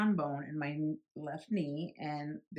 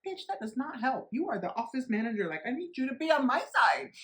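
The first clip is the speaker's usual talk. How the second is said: higher, louder scolding; exasperated snap; 6 semitones higher, 3 dB louder than usual. Retold or imitated. Patient scolding the office manager, exasperated and raised. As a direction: tell her off in disbelief.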